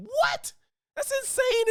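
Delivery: a squeaky what of pure disbelief; shocked disbelief. Real, not acted. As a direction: pitch shoots way up in shock. Outraged disbelief at racist claim.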